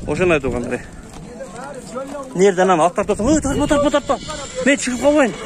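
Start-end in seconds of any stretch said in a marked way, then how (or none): 3.3-5.3 higher, imitating a flustered man begging not to be filmed; higher mock plea, don't film me; mock pleading. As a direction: act out a seller afraid of being shamed on camera. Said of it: "деп түшүнтүп калышат адамдар".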